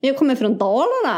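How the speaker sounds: sing-song Dalarna dialect; Dalarna accent. Acted. Taking a turn in the challenge, Dalarna accent. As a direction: swoop up and down like dalmål. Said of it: "Dalmål".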